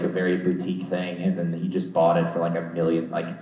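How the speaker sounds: ordinary talk, nothing marked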